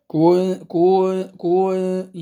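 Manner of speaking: كنتم خير أمة said slowly with كن stretched; added elongation. Shown as a demonstration. Teacher showing an error, stretching كن slowly. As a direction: drag out كن as an unintended madd. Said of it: "مد بدون أن يشعر".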